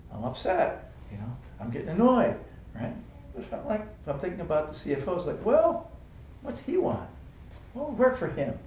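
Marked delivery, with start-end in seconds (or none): 5.4-8.6 much higher, light wondering tone; lightly voiced kindly musing. Speaker imagining caring thoughts about the CFO, higher light voice. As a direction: muse gently about what he wants.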